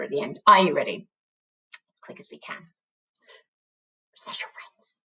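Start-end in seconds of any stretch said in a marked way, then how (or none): 1.7-4.9 silent mouthed whisper. Shown as a demonstration. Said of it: "sound it out just with my mouth".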